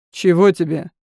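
loud, curt snap; bark. Acted. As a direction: bark it at the servant. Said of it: "рявкнул Элиф".

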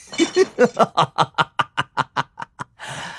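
long villainous chuckle, falling away; a drawn-out evil cackle; sinister laugh. A narrator's reading. Shoemaker after staring long at Tang Mo, sinister laugh. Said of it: "阴险地笑了起来".

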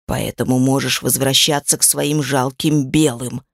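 low, scornful demon voice; contemptuous demon. A narrator's reading. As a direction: sneer it down low. Demon dismisses Nag. (презрительный голос демона)

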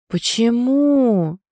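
long, high, whining groan; moaned question. A narrator's reading. Friend dismayed by the heroine's refusal, moaning. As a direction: stretch it into a plaintive moan. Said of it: "простонала в ответ подруга".

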